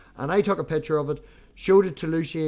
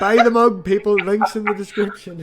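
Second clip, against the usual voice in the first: loud, higher shout to buy the mug; loud plug; 3 semitones higher, 8 dB louder than usual. Real, not acted. Pitching the merch mug to viewers, a loud call. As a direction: hawk the mug like a market trader.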